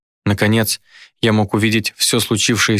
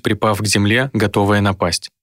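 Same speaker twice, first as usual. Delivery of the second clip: ordinary delivery, nothing marked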